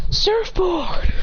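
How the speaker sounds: high-pitched anime attack call; mock battle call; calling a summon. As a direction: shout the move's name theatrically. Character calls out summon.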